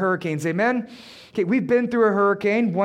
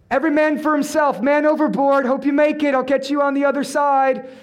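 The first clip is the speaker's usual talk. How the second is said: high, raised abandon-ship call; hammed-up farewell call; mock calling out; 4 semitones higher, 3 dB louder than usual. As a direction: imitate a deserter shouting back to the crew. Imagined Jesus abandoning ship, calling out.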